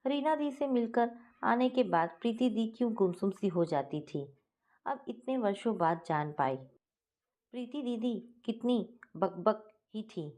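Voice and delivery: ordinary delivery, nothing marked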